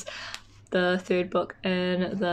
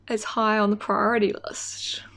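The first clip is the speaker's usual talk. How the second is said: ordinary talk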